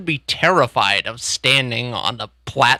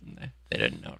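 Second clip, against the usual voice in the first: whispered, much lower; whispered aside; 12 semitones lower, 13 dB quieter, much faster than usual. Real, not acted. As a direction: drop into a secretive whisper.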